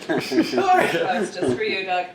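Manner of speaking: slow, loud, laughing dedication; teasing, giggling aside to a friend. Real, not acted. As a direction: drag it out while cracking up. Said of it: "mock-pointed dedication".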